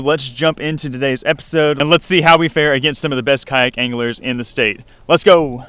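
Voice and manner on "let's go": hype call. Real, not acted. Hyped sign-off into fishing.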